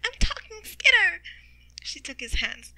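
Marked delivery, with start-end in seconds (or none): 0.0-1.2 high, loud delight at talking; elated shout. Acted. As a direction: cry out to a friend, pitch soaring. Shireen regains her voice.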